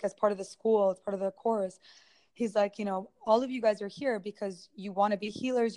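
normal talking voice

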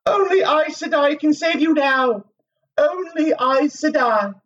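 very high, commanding female character; lashing voice. A narrator's reading. Moiraine's apparition warning Rand he has no choice, lashing voice. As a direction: deliver a high, forceful warning like a lash. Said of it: "her voice was a lash".

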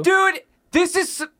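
high, loud exasperated protest; tantrum. Real, not acted. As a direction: burst out in protest, cut short. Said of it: "You wanna throw a tantrum".

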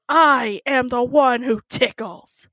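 slow, weighty mock-menacing declaration. Joking about the expected reply, slow villain voice. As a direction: spell it out like a TV villain.

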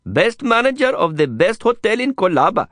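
high, bright, eager Indian guide; eager street guide. A narrator's reading. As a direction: sell it with a cheerful grin. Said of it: "Prabhakar interjected quickly".

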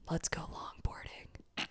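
breathy whispered suggestion, slow; whispered scheme. Retold or imitated. Friend retelling her drunk idea, whispered. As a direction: hush it like a secret plan.

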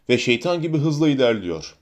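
usual speaking voice

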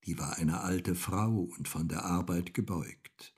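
ordinary delivery, nothing marked

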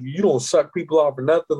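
ordinary talk

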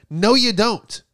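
ordinary talk, nothing marked

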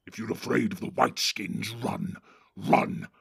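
gruff orc chief giving orders; Ugluk the orc captain. Acted. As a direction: bark orders at the cowardly Northerners. Ugluk voiced in story.

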